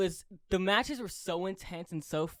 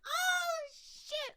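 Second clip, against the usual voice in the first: stunned surprise. Retold or imitated. Fan reliving a surprise debut, stunned. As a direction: gasp it out in disbelief. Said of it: "I'm like".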